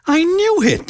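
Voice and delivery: high excited shriek; high delighted shriek. Acted. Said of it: "she practically shrieked".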